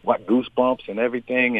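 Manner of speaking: ordinary talk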